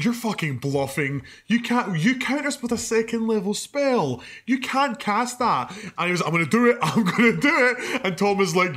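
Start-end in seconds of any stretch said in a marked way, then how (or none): 0.0-5.7 mock taunt. Taunting the villain mage. 6.2-8.0 laughing threat. Retold or imitated. Retelling the mage's threat.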